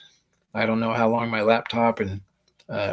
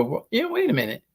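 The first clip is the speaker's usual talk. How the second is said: sudden realization; 11 semitones higher than usual. Retold or imitated. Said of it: "I go".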